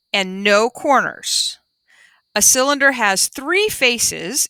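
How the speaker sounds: slow, clear storybook reading; reading to children. Acted. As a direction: read it out slowly for the class. Reading the class book aloud.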